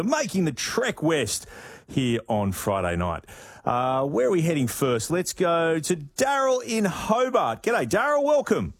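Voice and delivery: very high, drawn-out caller introduction; radio host greeting caller. Real, not acted.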